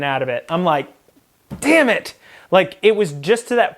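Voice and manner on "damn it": high, sudden mock outburst; exasperated curse. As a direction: spit out frustration in a higher pitch. Retelling reaction to leaking fuel.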